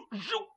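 quick high-pitched whoosh; imitated whoosh. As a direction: zip it out like a sudden dodge. Imitates the body dropping back.

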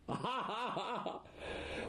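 real laugh. Real, not acted. Audience laughing at the joke, real laugh.

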